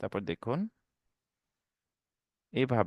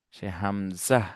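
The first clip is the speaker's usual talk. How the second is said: usual speaking voice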